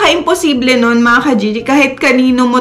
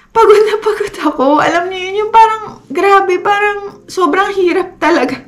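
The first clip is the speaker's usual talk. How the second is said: higher, strained voice close to tears; emotional, near tears; 8 semitones higher than usual. Real, not acted. Vlogger telling viewers how tired she was, near tears. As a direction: let the exhaustion crack through, pitched up. Retelling crying from exhaustion.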